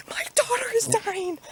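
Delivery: high whispered mock panic; hammed-up frantic whisper; overdramatic mom. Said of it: "I can be like".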